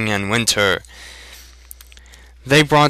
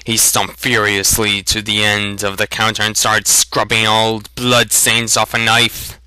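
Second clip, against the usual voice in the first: ordinary delivery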